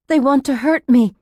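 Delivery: urgent, fearful insistence; frightened plea. A narrator's reading. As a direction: plead for protection. Frightened patient pleading with nurse.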